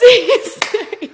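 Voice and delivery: loud, very high mock alarm cry; retold yelling. Onlookers spotting a sea snake, imitated yelling. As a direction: sound the alarm in an imitated shriek. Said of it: "then they start yelling".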